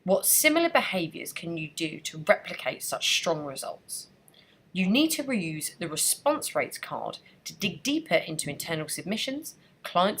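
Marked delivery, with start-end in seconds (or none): none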